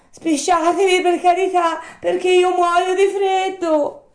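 high, loud puppet wail; pleading Pinocchio. Acted. Freezing puppet begging the snail to hurry, urgent high plea.

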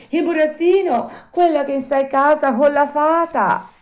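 high, loud puppet voice; Pinocchio calling up. Acted. Puppet asking for the Fairy at night, high calling voice. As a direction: call up loudly in a high puppet voice.

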